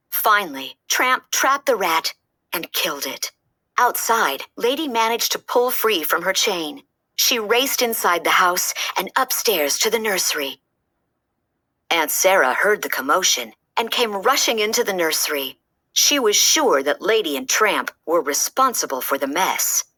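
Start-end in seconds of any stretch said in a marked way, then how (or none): none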